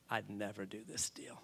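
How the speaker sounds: low, very soft verdict; hushed warning. Retold or imitated. Seasoned landlord advises against the deal, hushed. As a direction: drop nearly to a whisper for gravity. Mentor's grave verdict, retold.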